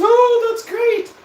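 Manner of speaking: very high, slow mock-gushing girlfriend; girlfriend's reaction. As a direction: drag it out sky-high like a sarcastic girlfriend. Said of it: "She goes".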